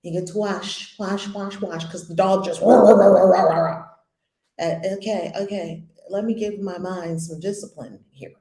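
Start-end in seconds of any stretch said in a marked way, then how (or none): 2.8-3.9 loud imitated dog barks; dog barking. Teacher mimicking her dog barking mid-lesson, loud barks. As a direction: bark like the dog interrupting.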